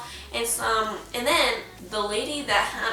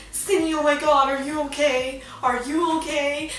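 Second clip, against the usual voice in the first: worried mom; 4 semitones higher than usual. Retold or imitated. Mom phones daughter after the car crash, worried. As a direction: imitate a panicked mom on the phone.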